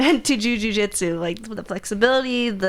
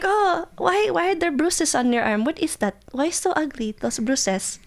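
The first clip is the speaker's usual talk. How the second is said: imitating Filipino mom; 8 semitones higher than usual. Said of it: "Or like, we're like".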